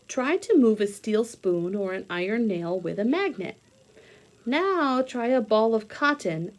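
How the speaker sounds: reading aloud. Acted.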